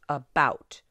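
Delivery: muffled A, loud stressed bout in "About". Shown as a demonstration. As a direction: hit "bout" hard in "About". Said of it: "is stressed".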